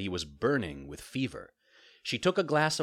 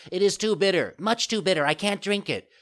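high, loud puppet complaint; whining refusal; 11 semitones higher, 7 dB louder than usual. A narrator's reading. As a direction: whine and protest like a child.

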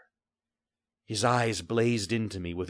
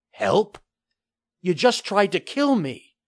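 put-on a boy shocked, pitched higher and louder; indignant disbelief; 12 semitones higher, 8 dB louder than usual. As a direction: throw his words back at him.

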